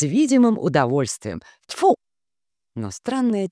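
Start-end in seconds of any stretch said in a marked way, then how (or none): 1.7-2.0 sharp high spitting Tfu; spit. Acted. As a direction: spit with relish.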